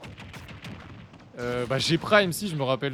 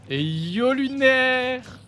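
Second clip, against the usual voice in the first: high, drawn-out shout of a name; excited caster's holler; loud hail; 9 semitones higher, 6 dB louder, much slower than usual. Real, not acted. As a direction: call out loud with a long rising cheer. Hailing Lunaire during the match.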